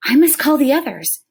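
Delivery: put-on eager young boy wanting to call family; boy's urgent thought. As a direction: voice the boy's sudden urge. Omri's thought voiced by narrator.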